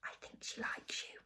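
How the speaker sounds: very soft, breathy whisper; hushed confiding whisper. A narrator's reading. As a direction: whisper it secretly to a friend. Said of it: "whispered Judy".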